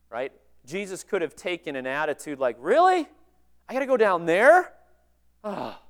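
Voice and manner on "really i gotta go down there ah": higher and louder, incredulous complaining; mock indignant grumble at being sent down; mock whiny protest. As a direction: play a sulky kid refusing a chore. Jesus imagined balking at the mission.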